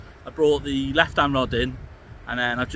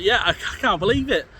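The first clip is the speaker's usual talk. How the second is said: excited; 8 semitones higher than usual. Real, not acted. Excited about a rare catch.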